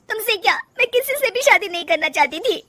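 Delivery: high voice collapsing into sobs; tearful young wife, acted sobbing at the end; breaking into tears. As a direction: start crying as you confess. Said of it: "Rajni रोने लगती है".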